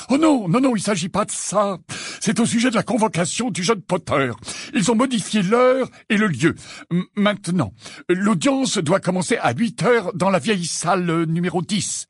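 put-on high, jittery, anxious old man; feverish old wizard. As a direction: fret out every word like a nervous old clerk. Perkins continues, panicked.

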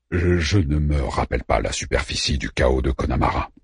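low, subdued figure voice; hesitant admission. A narrator's reading. Orpheus admits he does not remember, low and hesitant. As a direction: stumble at the start, keep it low. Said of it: "avoua Orpheus".

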